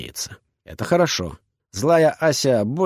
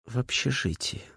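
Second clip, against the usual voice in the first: soft, barely voiced girl; whispered; 10 dB quieter than usual. A narrator's reading. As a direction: breathe it out timidly. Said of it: "шепчет".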